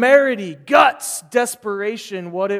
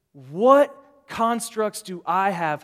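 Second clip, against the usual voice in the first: ordinary talk, nothing marked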